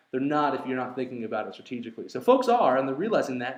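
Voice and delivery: ordinary talk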